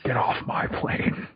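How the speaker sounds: breathy whisper, slow; whispered movie quote. Retold or imitated. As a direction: whisper the line slowly. Harrison Ford movie line whispered.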